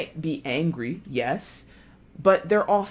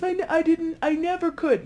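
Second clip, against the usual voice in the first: higher, stammering fright; mock scared stammer; 12 semitones higher than usual. Innocent person accused of stealing, a scared stammer. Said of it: "scared. It might be like this".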